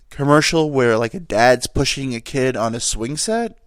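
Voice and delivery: normal talking voice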